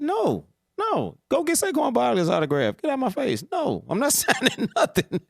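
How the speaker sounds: high amused imitation of the player's dismissal; mock player brush-off. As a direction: play the player shooing the kid off, cracking up. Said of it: "What he supposed to say".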